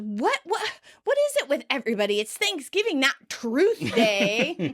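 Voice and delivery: high, defensive outburst; Ross. Acted. Ross fends off everyone's demands for truth, defensive. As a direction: protest it, rushing then drawing out the end. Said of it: "And Ross defensive".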